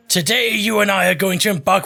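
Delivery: hint of a hammed-up pirate. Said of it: "Why am I talking like a pirate?".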